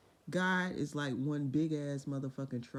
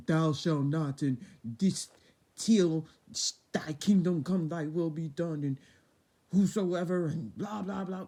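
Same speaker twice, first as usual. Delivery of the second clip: louder, solemn mock scripture; mock pulpit voice; 6 dB louder, slower than usual. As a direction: boom it out like a sermon. Mocking churchy God talk.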